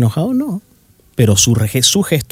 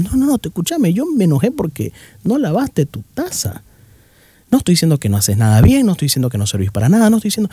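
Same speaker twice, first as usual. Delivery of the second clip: acted high-pitched defensive protest; pleading to be heard, pitched well up; exasperated protest; 6 semitones higher than usual. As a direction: push back fast and high, exasperated. Acted exasperated reply to partner.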